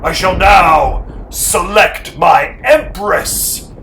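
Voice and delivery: loud, slow and booming; commanding proclamation. A narrator's reading. Harrison announcing his empress to the cowering people, booming. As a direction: proclaim it to the whole hall.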